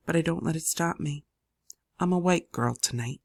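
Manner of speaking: usual speaking voice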